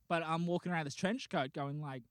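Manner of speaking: usual speaking voice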